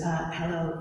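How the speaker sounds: put-on soft, hesitant hello from a scared young man; quietly, nervous at the door; timid kid greeting the father; nervous suitor. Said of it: "And Hosea goes".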